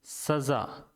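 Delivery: सजा whispered with a clear nukta ज़; whispered example. Shown as a demonstration. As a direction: breathe सजा as the model word. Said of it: "जैसे सजा".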